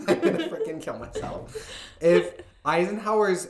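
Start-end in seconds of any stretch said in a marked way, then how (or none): 0.0-1.7 laughing through the words, high; cracking up mid-sentence, real laughter. Real, not acted. Friends recording a podcast, laughing hard at her own stumble.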